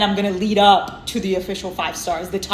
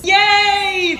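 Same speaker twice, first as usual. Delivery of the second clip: excited cheer; 12 semitones higher, 7 dB louder than usual. Real, not acted.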